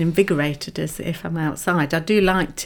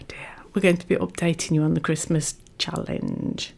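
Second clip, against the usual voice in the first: whispering; 3 semitones lower, 8 dB quieter than usual. Real, not acted. Whispered aside mid-intro.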